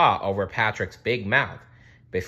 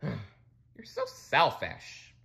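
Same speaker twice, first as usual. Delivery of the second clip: disappointed; 5 semitones higher, much slower than usual. Acted. Disappointed scolding.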